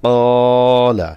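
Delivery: slow teaching demo of a long vowel; stretched vowel demo. Shown as a demonstration. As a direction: draw out the কা in কলা and keep লা short. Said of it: "লামে টান হবে না".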